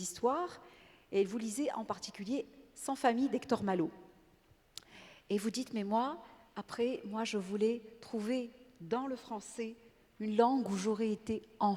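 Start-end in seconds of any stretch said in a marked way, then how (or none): none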